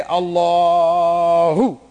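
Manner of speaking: Allahu stretched long and slow; drawn-out Allahu. Shown as a demonstration. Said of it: "you start by looking at your heart and you say".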